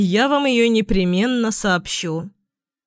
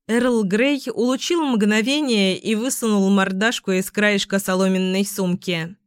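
ordinary delivery, nothing marked

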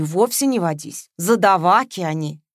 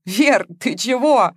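startled; 4 semitones higher, 6 dB louder, slower than usual. A narrator's reading. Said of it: "чуть не захлебнулась кофе".

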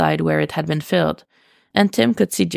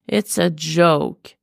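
slow, deliberate insistence; insisting; much slower than usual. Acted. Tim defending himself to Con, slow insistence. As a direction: lay each word down slowly to be believed. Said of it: "Tim insisted".

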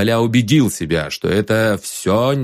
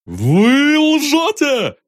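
high drawn-out outraged yell; shouting; much slower than usual. Acted. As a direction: scream the accusation slowly. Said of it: "прокричал Аркаша".

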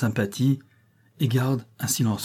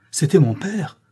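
anguished cry; 6 semitones higher than usual. Acted. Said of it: "Un cri, un hurlement de bête blessée".

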